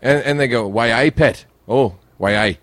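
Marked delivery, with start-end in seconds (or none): 0.7-1.5 lightly imitated Newcastle accent; Geordie accent. Shown as a demonstration. Newcastle local greeting someone, rough Geordie accent. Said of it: "They, they speak like that". 2.2-2.6 rough attempt at Geordie; Geordie accent. Shown as a demonstration. Quoting the local catchphrase, rough Geordie accent. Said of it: "They, they speak like that".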